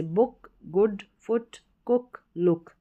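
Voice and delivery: बुक, गुड, फुट, कुक, लुक each with short u; short vowel examples. Shown as a demonstration. Teacher reading the short-sound word list, short vowel. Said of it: "यहाँ पर छोटे ऊ के रूप में है".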